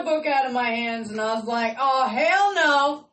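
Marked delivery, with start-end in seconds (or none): 1.8-3.0 slow, loud, drawn-out hell no; fed-up retort. Retold or imitated. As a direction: plant your feet and refuse. Book jerked from her hands.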